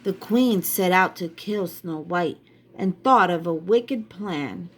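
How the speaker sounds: ordinary delivery, nothing marked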